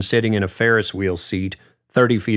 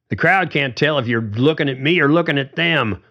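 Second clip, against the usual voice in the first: higher, mildly riled; a touch of rising irritation; riled Carly; 7 semitones higher than usual. A narrator's reading. Carly arguing back at George, mildly riled. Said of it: "Carly was getting riled now".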